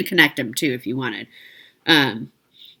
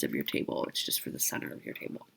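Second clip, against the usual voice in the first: whispering; 15 dB quieter, faster than usual. Real, not acted. Aside about the table runner.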